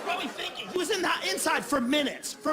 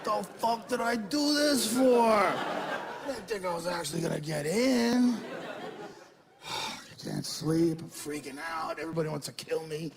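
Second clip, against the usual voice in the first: panicked president; 2 semitones lower than usual. Acted. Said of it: "probably like".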